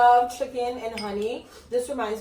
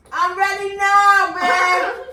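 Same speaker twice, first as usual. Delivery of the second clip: higher, louder and slow; emphatic; 9 semitones higher, 10 dB louder, much slower than usual. Real, not acted. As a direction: drag it out, loud and high. Repeats, then says it emphatically.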